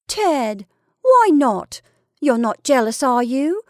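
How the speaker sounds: high, loud challenge; teasing challenge. A narrator's reading. Wife challenging her displeased husband, teasing challenge. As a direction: push the questions up high and loud.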